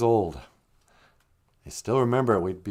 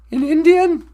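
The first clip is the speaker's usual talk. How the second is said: high, loud surprised "In Indian?"; surprised echo; 10 dB louder than usual. Real, not acted. Cohost reacting in surprise to the story. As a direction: shoot it back in disbelief.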